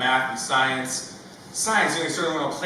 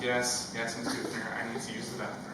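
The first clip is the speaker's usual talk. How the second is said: ashamed mumble; 4 semitones lower, 8 dB quieter than usual. Retold or imitated. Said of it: "And I'm like".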